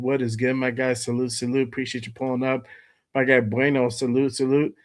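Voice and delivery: ordinary talk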